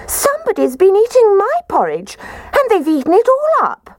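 put-on squeaky Baby Bear. A narrator's reading. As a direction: squeak it out like a little cub. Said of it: "Baby Bear positively squeaked".

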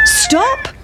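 high, crowing cockerel cry; a cock calling out sharp; cockerel's voice. Acted. The cockerel calls to the passing pancake, high and crowing. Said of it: "said the cock".

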